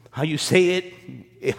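normal talking voice

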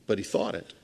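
ordinary delivery

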